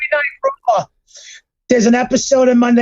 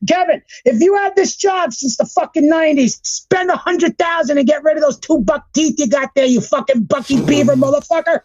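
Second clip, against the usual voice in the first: high-pitched heated tirade; angry rant; 5 semitones higher than usual. Real, not acted. Podcaster telling off a wrestling producer, angry rant. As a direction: rant straight at the absent target. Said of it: "The rant is real".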